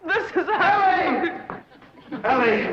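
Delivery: high, delighted cry through laughter; ecstatic call. Acted. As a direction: call out to her, swept away. Entranced man calls out to Ellie.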